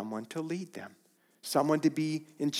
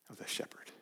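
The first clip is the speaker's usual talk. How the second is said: whisper; 16 dB quieter, faster than usual. Real, not acted. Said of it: "whispered reverence".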